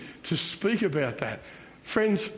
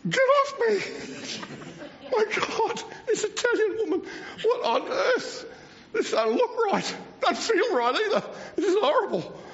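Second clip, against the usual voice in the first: much higher, squeaky panic, laughing through it; panicked inner voice. Retold or imitated. Pastor hugged by a stranger, mock panicked squeal. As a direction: squeal your alarm while half laughing. Trapped in a stranger's bear hug.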